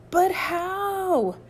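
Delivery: high, drawn-out wail of disbelief; exasperated outburst. Acted. Mike wailing to Rudy at being back at camp, exasperated. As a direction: cry it out in loud dismay.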